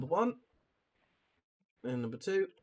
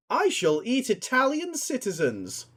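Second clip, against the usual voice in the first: slow, high, solemn dinner guest; 7 semitones higher, 4 dB louder, slower than usual. Acted. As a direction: declare it like a grand vow. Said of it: "I'll do all the guests".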